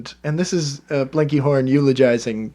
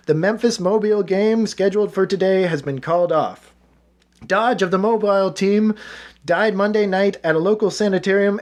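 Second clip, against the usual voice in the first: lightly raised newspaper read; reading a news article; 4 semitones higher than usual. Acted. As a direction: read the report out for your friend. Reads news article aloud.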